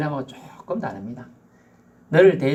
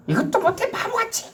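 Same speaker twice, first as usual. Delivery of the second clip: very high, mocking put-down; belittling scorn. Preacher voicing a put-down men hate, high and mocking. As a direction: belittle a man.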